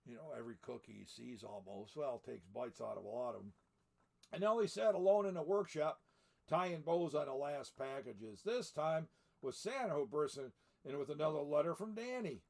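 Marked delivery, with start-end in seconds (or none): 4.3-12.4 lively read-aloud storybook voice; story narration. A narrator's reading. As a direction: read it like a picture book to children. Reads the book aloud.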